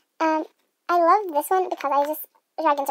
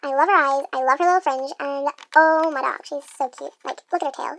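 ordinary talk, nothing marked

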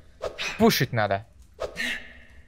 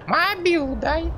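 high, slow thug voice; russian street thug; slower than usual. Pretend. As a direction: demand the phone like a street thug. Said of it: "я гопник".

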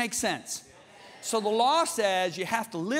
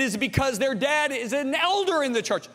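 higher, insistent rhetorical question; father drilling his sons; 6 semitones higher than usual. Retold or imitated. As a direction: quiz the boys like a preaching dad. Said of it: "I said".

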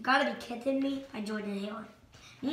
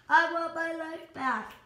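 higher, slow hammed-up wail; mock wail; 6 semitones higher, 4 dB louder, slower than usual. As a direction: moan it like a dramatic lament. Character wailing lament.

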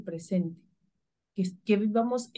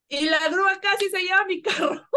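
high, teary laughter while telling it; words breaking into laughter, higher; laughing through it; 7 semitones higher than usual. Real, not acted. Laughing while telling her friend how the tow truck nearly took her car.

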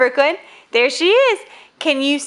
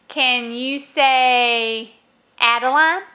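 usual speaking voice

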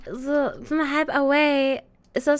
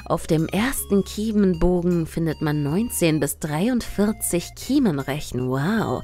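very low, fast reading voice; deep voice; 8 semitones lower than usual. Acted. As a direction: read it in a deep, husky register. Reads fish facts in deep voice.

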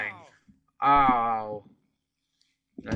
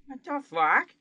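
baffled; 9 semitones higher than usual. Real, not acted. Confused by game.

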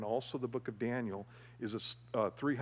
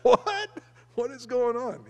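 much higher, much louder, laughing disbelief; stunned shouts; 13 semitones higher, 11 dB louder, slower than usual. Retold or imitated. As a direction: cry out in amazed confusion. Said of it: "everybody in the room is gonna go".

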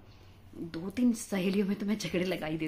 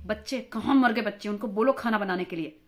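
put-on loud yell about the kids; louder, exasperated shout at her family; shouting into the phone; 6 dB louder than usual. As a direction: bark orders down the phone at home.

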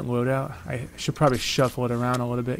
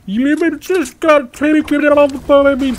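very high, loud, slow grating villain voice; Sludge impersonation; 12 dB louder, much slower than usual. Pretend. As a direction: screech it slowly like a cartoon villain. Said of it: "sludge impersonation can get a little bit grating".

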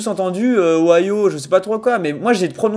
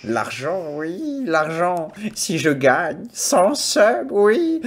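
mock greedy relish; 2 semitones lower, much slower than usual. Streamer dreaming of winning a hundred subs, mock greedy relish. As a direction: savour the money in a hammed-up croon.

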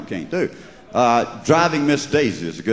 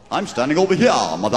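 put-on botched Southern accent. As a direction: imitate an actor's bad Southern accent, "here" as hee-ah. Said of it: "He said it here".

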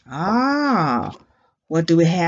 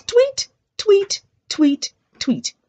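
four very high imitated tweets; high, short birdie peeps; 9 semitones higher than usual. Shown as a demonstration. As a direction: chirp four times like little birds. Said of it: "the birds say".

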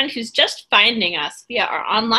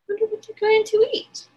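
plain reading voice

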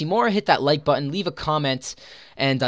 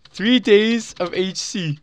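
savoring excited; 5 semitones higher, much slower than usual. Real, not acted. Repeats prize slowly.

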